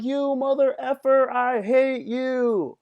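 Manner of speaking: high, loud drawn-out yell; hammed-up furious cursing; road-rage outburst. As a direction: blow up at a driver, stretching out the last words.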